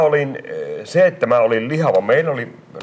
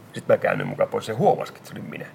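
ordinary talk

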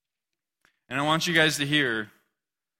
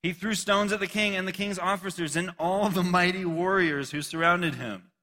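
scripture reading; 4 semitones higher, 3 dB louder than usual. Acted.

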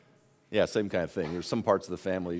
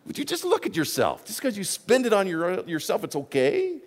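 Jesus rebuking; 11 semitones higher, 3 dB louder than usual. Retold or imitated. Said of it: "Jesus says".

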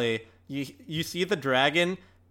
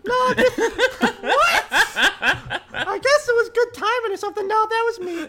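very high, giggling mock modesty; squeaky hero faking humility; coy falsetto; 5 dB louder than usual. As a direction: play it down in a squeaky voice. Same coy falsetto continues.

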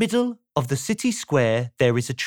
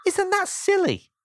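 high, delighted storyteller aside; playful aside. A narrator's reading. Narrator to the children, high and delighted. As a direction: squeak it out, tickled by the silliness.

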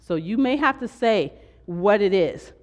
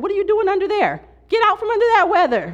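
much higher and louder, fast, playful scolding; pastor's rebuke; 13 semitones higher, 6 dB louder, faster than usual. Retold or imitated. Her pastor teasing a sick member, higher, louder playful scolding. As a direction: call them out from under the weather. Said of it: "I remember my pastor said".

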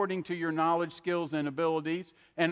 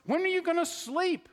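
mock skeptic; 11 semitones higher than usual. Mimicking doubting listener's question.

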